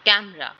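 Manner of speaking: "Camera" with a loud KAM; strong-then-light KAM-ra for "camera"; stress on first syllable. Shown as a demonstration. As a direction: punch कै in "camera", then fall away. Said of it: "के जो sound है इसमें ये stress".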